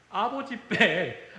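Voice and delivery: normal talking voice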